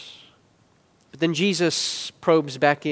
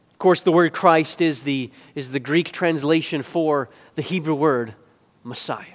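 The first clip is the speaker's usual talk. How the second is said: usual speaking voice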